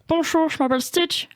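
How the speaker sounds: high, raspy cartoon alien; Stitch voice. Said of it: "il parle un peu comme ça".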